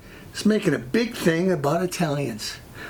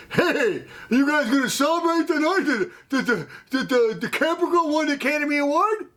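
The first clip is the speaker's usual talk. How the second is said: higher-pitched, mock-excited salesman; 8 semitones higher than usual. Salesman pestering Italian coworkers about the award, mock excited. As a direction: imitate an over-eager, stammering coworker.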